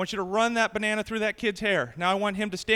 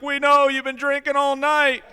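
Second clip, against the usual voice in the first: mocking drinking buddies; 6 semitones higher, 8 dB louder than usual. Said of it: "Everybody's like".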